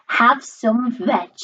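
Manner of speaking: ordinary delivery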